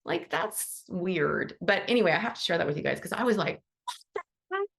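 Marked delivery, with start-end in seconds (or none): 3.8-4.7 high squeak. Retold or imitated. Her reaction to the student's AI homework.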